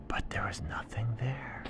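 spooky whispered narration; hushed reveal for the child. A narrator's reading. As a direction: breathe it as a secret. Suspense in bedtime story.

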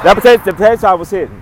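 excited. Real, not acted. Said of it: "raves about the food".

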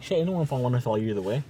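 normal talking voice

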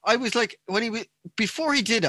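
retelling the shock, voice way up; excited disbelief. Retold or imitated. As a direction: voice leaps up in disbelief. Excited retelling before the knee.

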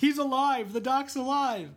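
hammed-up overjoyed cry, pitch way up; mock excited Marty. Marty thrilled to find Doc alive, mock excited.

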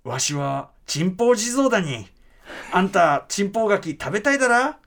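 lightly voiced Mikawa dialect jizo storytelling; Mikawa dialect reading. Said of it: "じゃあちょっと読んでみるね". (三河弁で軽く語る地蔵の読み聞かせ)

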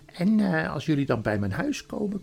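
high, sugary conspiratorial queen; scheming queen. Acted. Queen shares a secret with Edmund, coaxing and high. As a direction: flatter and coax in a high lilt.

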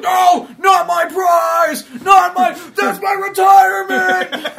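high, loud, slow hammed-up wail; panicked mock shout. Ray Stevenson's henchman begging the boss not to kill her, hammed-up panicked yell. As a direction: shriek like your payday is about to die. Villain panics over captive.

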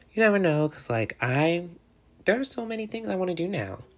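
high, loud burst of excitement; excited. Real, not acted. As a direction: gush about all the possibilities. Excited about future possibilities.

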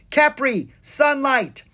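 high, loud call; Anna calls her dragon; cried out. Acted. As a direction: call out to your dragon overhead. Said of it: "Anna cried out".

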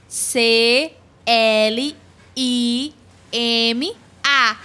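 spelled out very slowly, a pause after each letter; slow, low-pitched spelling-out. Shown as a demonstration. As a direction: say each letter name separately. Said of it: "Letra por letra".

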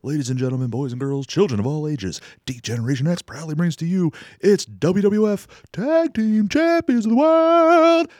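big announcer build, sung out at the end; ringmaster. Retold or imitated. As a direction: boom it out like a wrestling ring announcer. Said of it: "And he'd be like".

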